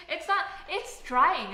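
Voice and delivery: laughing it out, loud and slow; laughing denial. Real, not acted. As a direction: protest through a laugh.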